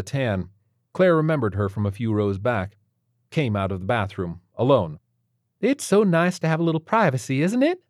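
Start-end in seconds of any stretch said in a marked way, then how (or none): 5.6-7.8 slight southern twang. Acted. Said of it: "with a slight southern twang".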